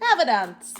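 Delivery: hammed-up posh English lady; playful put-on posh English voice; posh accent. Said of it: "Very posh".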